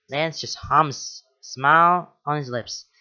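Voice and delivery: ordinary delivery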